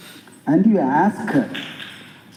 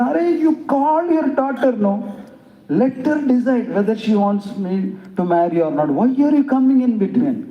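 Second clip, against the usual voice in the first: higher, pushy and exasperated; insistent suitor; 6 semitones higher than usual. Retold or imitated. Old suitor demanding the father step aside, higher and pushy. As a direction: push the father to back off.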